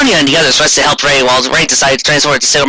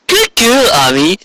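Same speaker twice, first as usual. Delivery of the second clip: higher sneering villain voice with a laugh; gloating villain; 6 semitones higher than usual. Acted. Soysite gloating over captured Ami, sneering villain voice. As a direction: purr it like a wicked sorceress.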